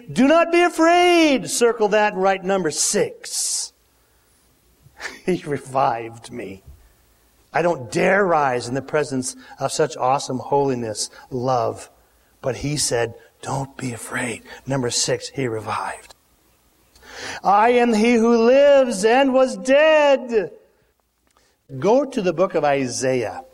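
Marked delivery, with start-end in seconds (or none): 0.1-1.5 high ringing command. Acted. Jesus reassures John. 13.4-14.4 a low, soft don't be afraid; gently calm his fear; soft reassurance. Acted. Jesus quietly comforting the fallen John, low and soft. 17.4-20.5 loud, high and slow; solemn proclamation. Acted. Jesus proclaiming himself to John, loud slow and high.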